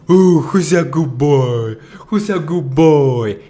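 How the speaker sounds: put-on high, slow sing-song baby talk to the dog. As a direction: coo it like you're praising a puppy. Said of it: "you speak to babies as if they were idiotic".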